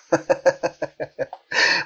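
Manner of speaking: acted trickster's laugh; sly laugh. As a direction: chuckle to yourself at a successful trick. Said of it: "riendo dijo".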